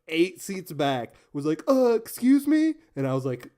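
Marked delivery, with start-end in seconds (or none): 1.7-2.7 high, slow mocking voice of a keen student; mocking nerdy classmate. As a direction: mimic the eager guy eight seats back, slow and high.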